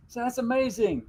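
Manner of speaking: high amazed "amazing" again in aside; "amazing" said high as a wry echo; repeat. Retold or imitated. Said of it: "repeats own quote".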